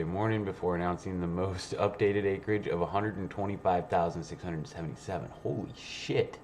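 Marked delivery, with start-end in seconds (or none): none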